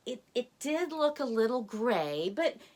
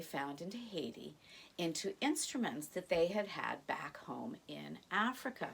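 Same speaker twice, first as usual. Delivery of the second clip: hushed aside; 4 semitones lower, 7 dB quieter than usual. Real, not acted. Storyteller to children, whispering. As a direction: drop to a whisper.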